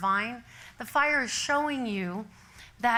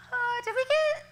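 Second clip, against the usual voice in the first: very high, puzzled voice; hammed-up confusion; 13 semitones higher than usual. Driver realising the road is unfamiliar, confused. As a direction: trail upward as if suddenly lost. Retold realisation of being lost.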